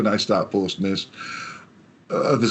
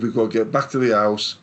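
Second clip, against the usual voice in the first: ordinary delivery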